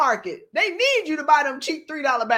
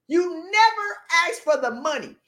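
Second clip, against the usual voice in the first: ordinary talk, nothing marked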